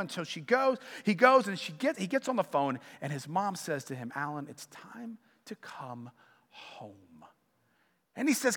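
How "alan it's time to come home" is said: much softer, gentle mother's plea; tender mother. Retold or imitated. Mother on the phone begging her lost son to come home, soft and tender.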